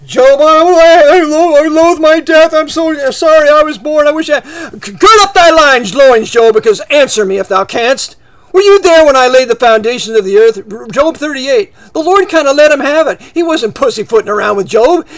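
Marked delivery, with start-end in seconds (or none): 0.0-4.4 whining Job. Acted. Job complaining to the Lord. 4.4-10.9 stern Lord voice. Acted. The Lord rebukes Job.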